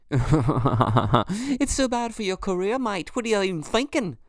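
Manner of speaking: high, slow, put-on British accent scolding; a British bloke telling him off in a mocking British accent. Host mocks his career worries in a British accent. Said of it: "There's a lot of accents right in that one".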